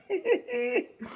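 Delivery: sudden high giggle; high burst of laughter. Real, not acted. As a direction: crack up laughing. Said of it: "I can't stop laughing".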